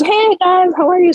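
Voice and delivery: high, bubbly mock group hello; cheerful greeting. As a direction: greet everyone in a high, friendly sing-out. Acting out the girls' group greeting.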